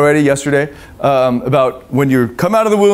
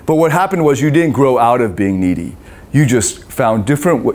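ordinary delivery

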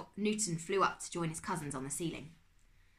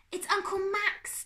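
high, loud, drawn-out cry of recognition; excited exclamation; 8 dB louder, slower than usual. A narrator's reading. Darkus recognises his trapped uncle, excited exclamation. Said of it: "Darkus exclaimed".

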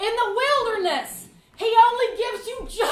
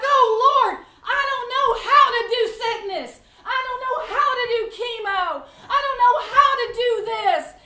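desperate prayer; 6 semitones higher, 6 dB louder than usual. Retold or imitated. Acted prayer in desperate sickness.

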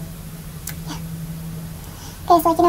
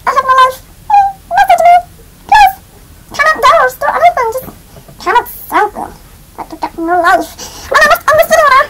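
very high, loud chipmunk squeal; 10 semitones higher, 10 dB louder than usual. Pretend. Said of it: "do the chipmunk voice until I end this video".

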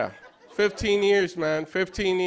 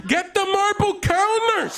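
high, slow mock yell at the screen; 13 semitones higher than usual. Yelling at the TV renovation show like a football fan. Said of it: "I was yelling at the T V".

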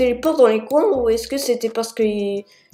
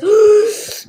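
sharp gasp; 10 semitones higher, 7 dB louder than usual. Real, not acted. Poursuivi, frayeur soudaine.

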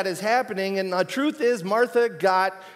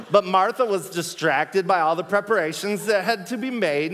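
put-on Valley Girl reading; 2 semitones higher than usual. Shown as a demonstration. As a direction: read scripture as a laughing Valley Girl. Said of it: "it's my Valley Girl version".

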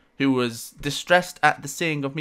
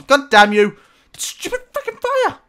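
loud curse that jumps much higher into a shriek; furious yell; 11 semitones higher, 5 dB louder than usual. Real, not acted. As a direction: rage at the fire that keeps burning you. Burning in game, furious.

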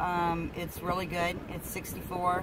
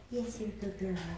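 softer gentle cooing; 7 dB quieter than usual. Real, not acted. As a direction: hush it lovingly, almost under breath. Soothing pet monkey.

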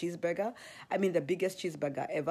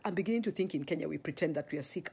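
ordinary talk, nothing marked

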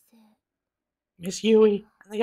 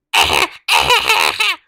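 low laugh; 8 dB louder than usual. Acted. Laugh in character.

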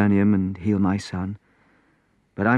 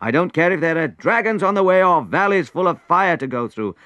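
loud and higher, I'm going anyway; determined shout; 9 semitones higher, 5 dB louder than usual. Acted. Prince shouting down his advisor's excuse, determined. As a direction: declare it with fierce resolve.

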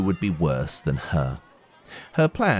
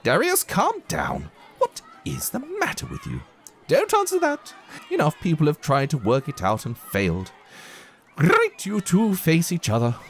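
much higher fluttery teacher voice; flustered teacher; 10 semitones higher than usual. Acted. Teacher flapping at the pupils, high-pitched. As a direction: play the harried teacher.

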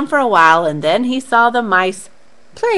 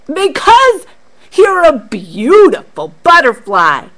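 monkeys flattering; 4 semitones higher, 7 dB louder than usual. Acted.

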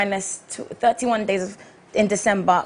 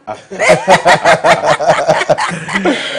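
laughing; 6 semitones lower, 8 dB louder than usual. Real, not acted. Laughs at own joke.

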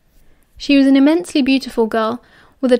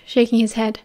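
ordinary delivery, nothing marked

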